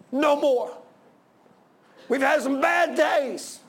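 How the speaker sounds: high shouted plea to God; anguished pulpit outcry; impassioned preaching. Real, not acted. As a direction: cry out at full force, stretching the last words. Preaching at full force, pleading.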